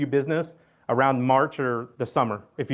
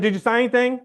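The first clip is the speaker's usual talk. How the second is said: urgent repeat; 7 semitones higher than usual. Retold or imitated. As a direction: push the question again with urgency. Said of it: "I said".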